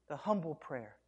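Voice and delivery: ordinary talk, nothing marked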